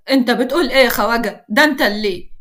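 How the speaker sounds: ordinary delivery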